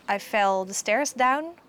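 mock child learner, slow and careful; quoting a child. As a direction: say each word slowly like a child's mistake. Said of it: "a bilingual child could say something in English like".

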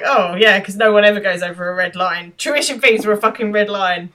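loud, scathing mock agreement; acted-out sarcastic outburst. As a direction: pile on the sarcasm, getting indignant and loud. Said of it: "can't resist the urge to be sarcastic".

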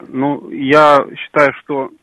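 ordinary delivery

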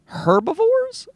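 HERBIVORES squeaked high, h sounded, drawn out and rising; mock puzzled question. Podcaster playing with how herbivores is said, high mock-puzzled question. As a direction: say "Herbivores" slowly with an audible h, soaring up into a question.

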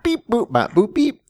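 high beeping robot voice; toy robot voice. Pretend. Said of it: "I am a robot".

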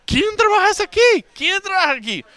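loud, high broken Spanish, fed up; almost yell the question in frustration; exasperated. Retold or imitated. Exasperated tourist asking who works.

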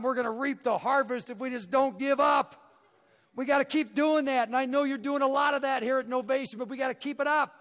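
high, loud preacher's build to the moral; preaching. Acted. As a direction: drive the lesson home like a revival preacher. Preaching the moral at full force.

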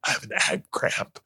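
strained, barely voiced gasp; in pain. Cramping man tries to explain to his date, strained. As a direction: squeeze the words out softly through pain. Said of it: "I'm in pain".

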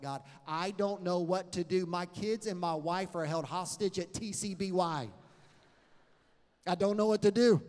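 rising to a loud desperate cry; crying out to God. Retold or imitated. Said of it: "began to cry out to God".

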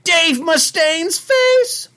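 hatch a plan in an excited cartoon squeal; hammed-up high cartoon sidekick; Shaggy. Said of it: "I have to hear the Shaggy".

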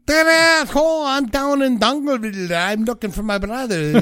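high, bright-eyed put-on Irish accent; hammed-up Irish lilt, pitched well up; mock Irish accent. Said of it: "slightly Irish in there".

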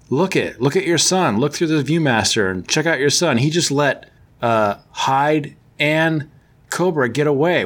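ordinary talk, nothing marked

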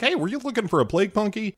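high, fast caller voice; chirpy rushed phone voice; light imitation of an official; mock caller. CDC calling, quoted.

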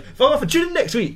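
high, snotty farewell; mock sign-off. Host storming out on his co-host, high snotty farewell. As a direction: squeak it out as you storm off.

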